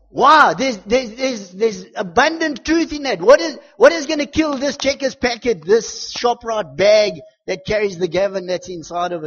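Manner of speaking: loud, high, impassioned preacher; full-force preaching. Real, not acted. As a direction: drive it home to the congregation.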